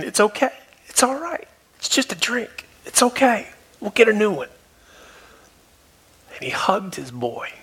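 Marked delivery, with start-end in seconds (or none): 0.0-4.5 put-on dad lovingly calming his crying boy, high and gentle; gentle reassurance. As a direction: reassure him tenderly, pitched well up. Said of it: "I could just tell he was saying".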